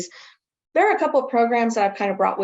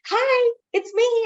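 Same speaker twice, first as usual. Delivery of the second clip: high, slow sing-song hello; sing-song hi to a caregiver; cheery hello; 13 semitones higher, 3 dB louder, slower than usual. Retold or imitated. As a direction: stretch out a bright hello. Greeting staff on video.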